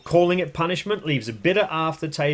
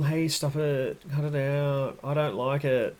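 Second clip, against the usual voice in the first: soft, slow, mild telling-off; gentle scolding; 4 dB quieter than usual. Retold or imitated. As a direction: gently ask the dog to stop. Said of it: "We go with these gentle".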